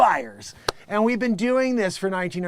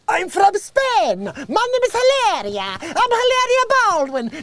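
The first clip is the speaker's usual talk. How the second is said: much higher, loud fake Spanish accent; 13 semitones higher, 7 dB louder than usual. Comic playing Hilaria inventing her Spanish self, loud and high. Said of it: "she's like".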